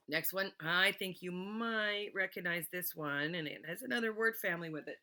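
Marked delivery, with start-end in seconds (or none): none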